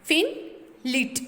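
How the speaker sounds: "फिन, लिट" with a quick short i; short I sound. Shown as a demonstration. Teacher shows students the short I, brief vowel in "फिन, लिट". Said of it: "आई की short vowel sound".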